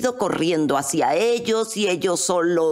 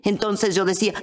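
ordinary delivery

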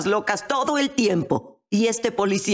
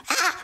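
imitated scream; 11 semitones higher than usual. Imitating her screaming family, high short shriek. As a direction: mimic a short startled scream. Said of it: "Y ellos gritaban".

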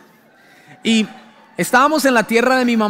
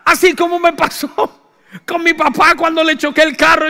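laughing aside; 6 semitones higher than usual. Real, not acted. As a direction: chuckle while confessing. Laughing aside about his crash.

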